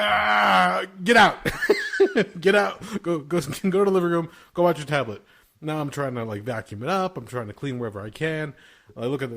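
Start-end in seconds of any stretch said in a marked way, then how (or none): 0.0-1.4 loud high yell; retold shout at toddler covered in powder; yelling at kid. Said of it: "I'm like". 1.4-5.2 loud, higher, laughing through the order; laughing dad ordering kid out; laughing yell. Retold or imitated. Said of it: "I'm like".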